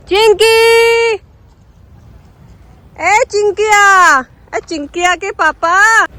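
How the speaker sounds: long, slow, high call; drawn-out calling. Real, not acted. Vlogger calls the puppy slowly from afar, drawn-out high call. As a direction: stretch the name out as you call.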